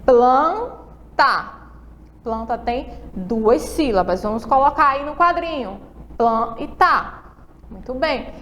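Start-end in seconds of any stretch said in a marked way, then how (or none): 0.0-1.5 Planta split into plan-ta, counted on fingers; syllable by syllable. Shown as a demonstration. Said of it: "Vamos lá usar os dedinhos". 6.2-7.2 slow Plan and tá, each syllable separate; teacher breaking a word into syllables for children; split into syllables. Shown as a demonstration. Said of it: "Vamos lá usar os dedinhos".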